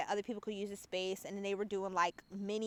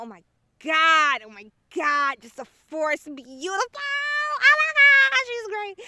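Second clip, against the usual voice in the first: high, loud squealing praise; excited gushing; 8 semitones higher, 14 dB louder than usual. Real, not acted. Rave to listeners about the mistress, excited high squeal. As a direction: gush about her, near shrieking.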